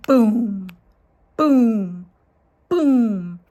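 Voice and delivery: loud, low booming; booming steps. A narrator's reading. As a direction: thump each boom like a footstep. Giant's footsteps approaching.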